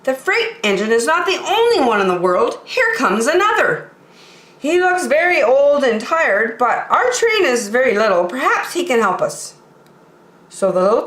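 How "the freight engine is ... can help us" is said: high, quick and hopeful clown voice; eager toy clown. Acted. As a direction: talk the toys out of their gloom.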